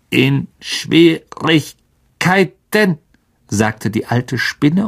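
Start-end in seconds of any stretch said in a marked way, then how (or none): 0.1-3.0 high, very slow, syllables broken apart; clicking spider, slightly concerned. A narrator's reading. The old spider hearing of a friend's trouble, concerned clicking voice. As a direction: click out the question with a hint of worry. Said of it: "ein wenig Besorgnis aus".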